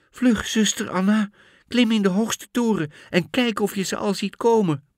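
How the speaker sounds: high-pitched, urgent plea; urgent girl. A narrator's reading. Girl sends her sister up the tower, urgent. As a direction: hurry your sister along in a high voice.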